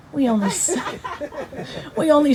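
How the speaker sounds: laughing through the line. Real, not acted. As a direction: giggle your way through it. Breaks into laughter reading poem.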